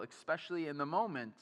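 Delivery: ordinary talk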